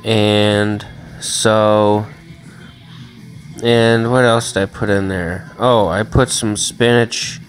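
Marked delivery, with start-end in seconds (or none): none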